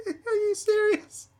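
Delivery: very high, slow, squeaky-with-laughter disbelief; laughing disbelief. Real, not acted. Reactor cracks up at being called comrade, laughing disbelief. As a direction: force the words out through real laughter.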